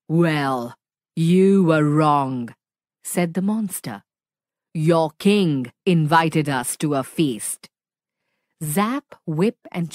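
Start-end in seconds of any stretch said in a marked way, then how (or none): 0.1-2.5 lower, louder monster voice; deep booming voice. Acted. Monster demanding why the friends attacked, deep booming. 4.7-7.7 deep booming voice. Acted. Monster demanding why the friends attacked, deep booming.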